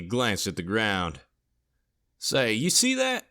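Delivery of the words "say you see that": high, rising drawled question; thick drawl. A narrator's reading. Sergeant spotting something on the ground, in a thick drawl. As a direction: drawl it, swinging up high at the end. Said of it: "said the sergeant in a thick drawl".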